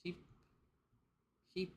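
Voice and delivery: ordinary talk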